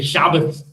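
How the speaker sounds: "Shabbos" stressed on first syllable, ending in s. Shown as a demonstration. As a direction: say "Shabbos" as SHAH-bus.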